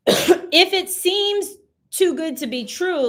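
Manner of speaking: slow, emphatic caution; slow warning. Real, not acted. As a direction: draw out the warning so it sinks in. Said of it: "let's look into it".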